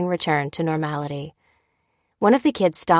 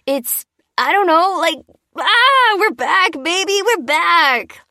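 very high, loud reveller voice; euphoric whooping; 12 semitones higher, 5 dB louder, slower than usual. Acted. Interviewee celebrating the power's return to reporter, euphoric.

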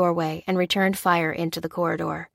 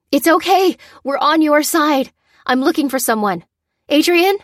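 desperate cry; 9 semitones higher, 7 dB louder than usual. A narrator's reading. Lucy calming terrified prisoners, loud high cry. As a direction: shout reassurance over the panicked crowd. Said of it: "she cried".

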